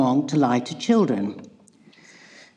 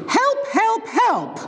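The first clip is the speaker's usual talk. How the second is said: high mock scream for help; much slower than usual. Goldilocks seeing the three bears, high mock screams. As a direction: shriek it up high, hammed-up terror. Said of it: "she screamed".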